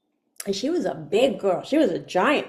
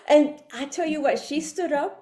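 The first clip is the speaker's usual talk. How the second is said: ordinary talk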